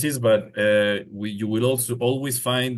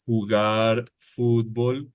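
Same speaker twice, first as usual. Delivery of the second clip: slow example. Shown as a demonstration. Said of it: "if you say".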